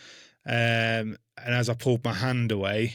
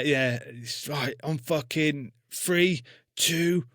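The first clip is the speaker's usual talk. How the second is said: countdown; 3 semitones higher, much slower than usual. Retold or imitated. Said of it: "shouting at me".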